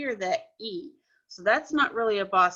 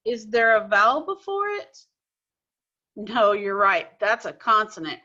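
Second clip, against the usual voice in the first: usual speaking voice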